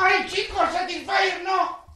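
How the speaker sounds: high, mock scandalised cry; mock outrage. Host fakes shock at friends' vibrator jokes, high mock squeal. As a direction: squeal it in fake shock, slowly.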